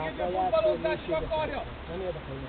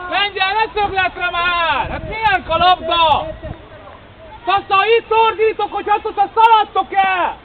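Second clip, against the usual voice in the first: frustrated coach yelling; exasperated shout; 7 dB louder than usual. Real, not acted. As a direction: tell them off across the field. Coach scolding players loudly.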